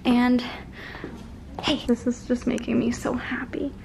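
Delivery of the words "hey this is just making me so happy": soft whispered aside. Real, not acted. Aside to the camera beside the puppy, whispered. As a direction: whisper so the puppy stays calm.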